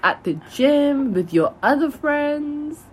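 slow, drawn out and rising; slow dreamy list. Pretend. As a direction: savour each place slowly.

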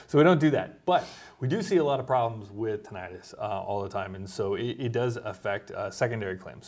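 ordinary talk

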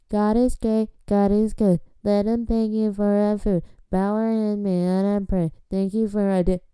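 sing-song preschool prayer; childish rote grace. Acted. Grown guest saying preschool grace, mock childish sing-song. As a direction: chant it evenly like a memorized rhyme.

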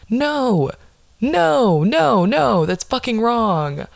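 high, drawn-out noes of protest; hammed-up, high-pitched indignation; outraged refusal. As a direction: wail your objection in mock outrage. Outraged reaction to being told undress.

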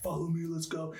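rallying call. Retold or imitated. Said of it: "I'm like".

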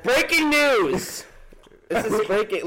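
slow, high mock news anchor. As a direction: announce it like breaking news.

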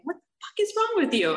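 high-pitched mock yell; acted-out outburst, pitch jumping high; retold scream. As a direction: imitate an exasperated scream without full volume. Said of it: "he'd start screaming at me".

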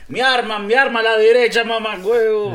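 high, loud Zulian mock voice; Maracaibo accent. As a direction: shout it like a rowdy maracucho.

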